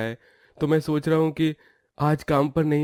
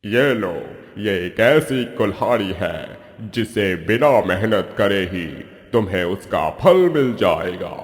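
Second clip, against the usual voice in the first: voice from the sky; 7 semitones lower than usual. Acted. Magic cave answering the lazy man, booming divine voice. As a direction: speak as an unseen god from above. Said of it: "Akashvani से आवाज आती है".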